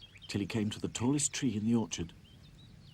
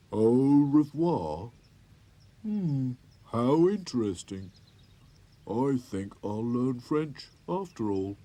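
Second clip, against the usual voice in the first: puzzled toy voice; 3 semitones higher, 8 dB louder, slower than usual. A narrator's reading. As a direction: think it through aloud, slowly. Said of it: "said Sad Sack".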